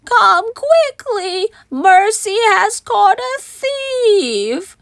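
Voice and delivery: high, drawn-out excited call; acted excited high voice. A narrator's reading. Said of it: "Baby林肯很激动".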